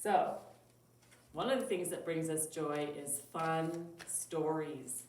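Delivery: put-on lively storybook voice for kids; reading to children. Teacher reading a Christmas story to children, lively storybook voice. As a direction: read it bright and expressive. Teacher reads picture book.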